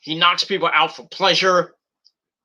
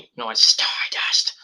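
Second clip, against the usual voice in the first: whispered aside; 6 semitones lower, slower than usual. Real, not acted. Aside about Cody's Stardust gimmick.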